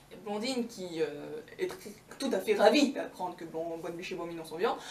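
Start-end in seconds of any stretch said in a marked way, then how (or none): none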